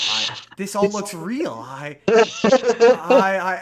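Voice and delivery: retold rising panic. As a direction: act out a man stammering in mounting dread. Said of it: "and he's like".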